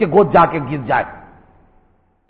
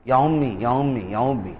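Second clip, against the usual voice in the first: each یا امی softer and lower than the last; fading tender call; 4 semitones lower, 7 dB quieter, slower than usual. Retold or imitated. Prophet calling out to the dying mother, tender and fading. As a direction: call gently, fading away. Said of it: "کہا".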